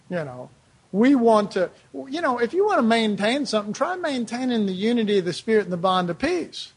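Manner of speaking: usual speaking voice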